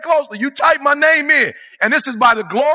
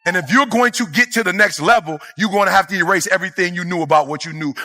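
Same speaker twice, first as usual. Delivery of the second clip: ordinary talk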